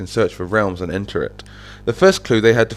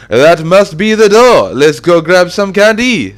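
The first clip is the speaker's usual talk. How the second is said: high, loud, drawn-out character yell; big, high-pitched shouted discovery; excited shout; 8 semitones higher, 10 dB louder, slower than usual. Acted. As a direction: holler it slowly to rally your friends. Excited character spots the door.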